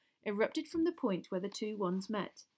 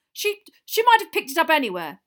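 high, loud stammered excuse; flustered retort; 9 semitones higher, 14 dB louder than usual. A narrator's reading. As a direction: blurt out a panicked explanation. Flustered Diggory concedes.

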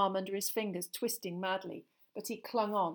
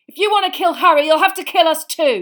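high, very loud, fast challenge; fierce defiance; 9 semitones higher, 17 dB louder, faster than usual. A narrator's reading. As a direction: shout it fiercely, standing your ground. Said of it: "he said fiercely".

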